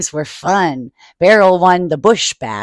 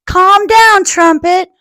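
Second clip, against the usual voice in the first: put-on high, louder little girl's voice; little sister; 10 semitones higher, 7 dB louder, slower than usual. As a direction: plead with him in a high child voice. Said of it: "said Tilly".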